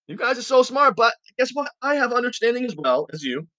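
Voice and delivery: sarcastic, high-pitched retort; Job. Acted. Job tells his friends he understands too, sarcastic. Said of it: "You can sense his sarcasm here".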